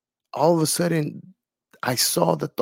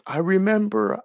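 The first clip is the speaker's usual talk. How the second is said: ordinary delivery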